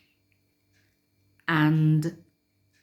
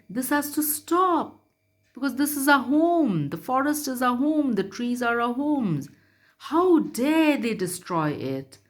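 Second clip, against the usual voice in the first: much higher, faster indignant lion voice; angry lion; 7 semitones higher than usual. Retold or imitated. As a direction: roar out the lion's anger. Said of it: "the lion roared and said".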